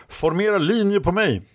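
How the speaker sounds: high-pitched military order; rally the soldiers with pitch well up; commanding call. A narrator's reading. Red Lady orders soldiers into line, high commanding call.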